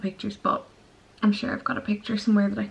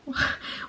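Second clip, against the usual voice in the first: short laugh; 7 dB quieter than usual. Real, not acted. Laughs at own word slip.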